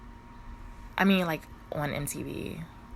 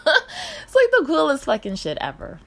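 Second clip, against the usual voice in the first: loud, high laughing delight; giddy laughing outburst; laughing excitedly; 11 semitones higher, 10 dB louder than usual. Real, not acted. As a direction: laugh through it, thrilled. Laughs about dream transit routes.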